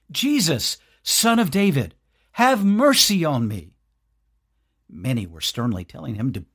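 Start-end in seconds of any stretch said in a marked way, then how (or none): 0.1-3.7 the beggar's raised, pleading cry. A narrator's reading. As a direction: call out louder, begging for mercy. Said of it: "he began to cry out and say".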